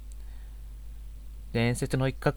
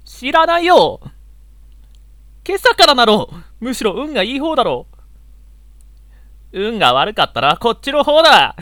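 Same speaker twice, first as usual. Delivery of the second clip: high, loud indignant yelling; exasperated shouting; 8 semitones higher, 12 dB louder than usual. Acted. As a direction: yell it out in frustration. Exasperated outburst at the girl.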